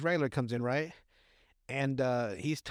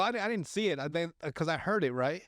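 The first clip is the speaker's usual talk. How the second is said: ordinary delivery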